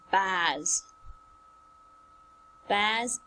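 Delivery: "bars" as the fish word, drawn-out vowel; bass, the fish. Shown as a demonstration. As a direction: say "bars" with an open, held a. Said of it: "as a kind of fish".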